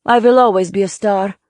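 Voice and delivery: loud, theatrical Polish diva; theatrical Polish-accented boast; thick Polish accent. A narrator's reading. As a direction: declare it grandly in a heavy Polish accent. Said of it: "said in her thick Polish accent".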